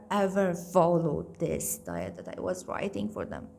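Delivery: ordinary delivery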